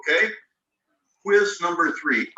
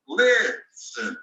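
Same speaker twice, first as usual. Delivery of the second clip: listen starting high on lis and falling; stress on LIS. Shown as a demonstration. Teacher contrasting with begin, first syllable high. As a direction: start listen high, then let it drop. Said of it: "high at the beginning".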